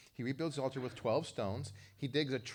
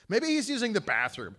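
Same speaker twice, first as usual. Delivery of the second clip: mocking taunt; 10 semitones higher, 9 dB louder than usual. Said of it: "Elijah literally says that".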